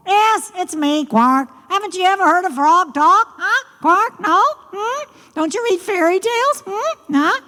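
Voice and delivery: high, fast croaky frog voice with quark sounds; a cheeky frog scolding the girl; croaking frog. Acted. Frog speaks.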